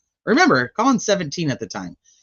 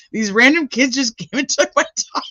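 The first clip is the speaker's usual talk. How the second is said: mock complaining woman; 8 semitones higher, 4 dB louder than usual. Mimicking the woman's police call.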